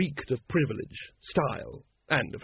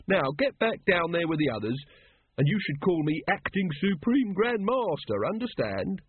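self-important, higher-pitched lodge leader; Brother Watchtower; 5 semitones higher than usual. Acted. Brother Watchtower ordering a brother back among the others, self-important. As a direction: give orders with officious pomp.

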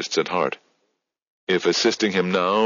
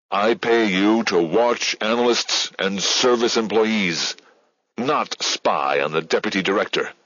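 barely controlled anger; 4 semitones higher than usual. A narrator's reading. Director dresses down Midge, barely controlling his anger. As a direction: keep the lid on the rage, just. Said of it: "barely controlling his anger".

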